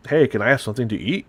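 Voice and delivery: Canadian accent, words running fast into one; rapid Canadian accent. Shown as a demonstration. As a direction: blur the words together like a quick Canadian. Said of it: "mine is rapid".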